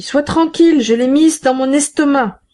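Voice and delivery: voix de femme rauque, bien plus aiguë; répond de loin, rauque et sûre d'elle; voix rauque de la Thénardier. A narrator's reading. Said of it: "La voix rauque de la Thénardier répondit". (la Thénardier rassure son mari depuis le couloir, voix rauque)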